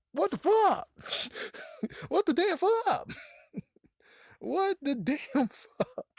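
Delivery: very high, cracking with laughter; laughing outrage. Real, not acted. Reacting to the ghost's rule, laughing in disbelief. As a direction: squeal it out through laughing.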